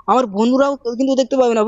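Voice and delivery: usual speaking voice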